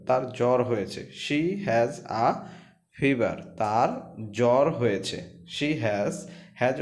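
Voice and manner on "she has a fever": words said slowly and clearly; slow classroom dictation; slow reading. Shown as a demonstration. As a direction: read it slowly so students follow. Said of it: "teacher reads sentence slowly".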